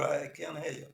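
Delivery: ordinary talk, nothing marked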